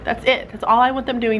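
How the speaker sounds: ordinary delivery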